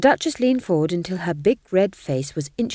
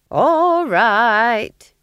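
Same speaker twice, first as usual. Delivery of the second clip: loud, high, drawn-out; old witch unafraid in the dark; challenging call; 5 semitones higher, 8 dB louder than usual. A narrator's reading. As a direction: call out to someone lurking. Nanny challenges a hidden watcher.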